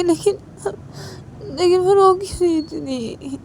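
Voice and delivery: put-on high, slow child's voice, sobbing; crying little girl. Little girl after the call cut, sobbing with worry. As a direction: sob out each question like a heartbroken child. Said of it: "वो रोए जा रही थी".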